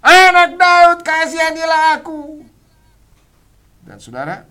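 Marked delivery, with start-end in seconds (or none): 0.0-2.4 very high, very loud desperate scream; loudest shout. Retold or imitated. Blind man screaming over everyone to Jesus. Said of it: "makin keras dia berteriak".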